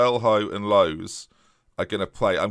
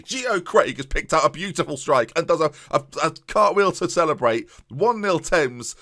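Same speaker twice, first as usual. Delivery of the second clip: rising, louder commentator's goal cry; excited goal call; 8 semitones higher, 4 dB louder than usual. Real, not acted. Goal scored in his commentary.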